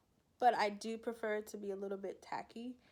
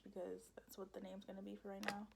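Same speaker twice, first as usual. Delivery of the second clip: whispered joking aside; 10 dB quieter than usual. Real, not acted. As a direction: say it under your breath.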